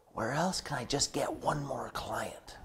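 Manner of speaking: slow, breathless whisper to yourself; inside voice whisper. Retold or imitated. Coach whispering her hidden worry, soft whisper. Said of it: "inside voice".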